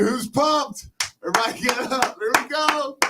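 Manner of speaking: hyped up. Acted. Hosts hyping up the text segment.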